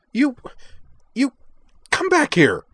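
very high, loud, spluttering yell; splutter, then yell after someone leaving; outraged shout. A narrator's reading. Liza calling after Sky as he leaves, outraged shout. Captain calls after departing insulter.

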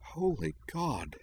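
high, slow, hushed disbelief; shocked whisper. A narrator's reading. Girl seeing her schoolmate's fallen body, hushed shock. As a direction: draw it out softly, aghast. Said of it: "Shiori whispered".

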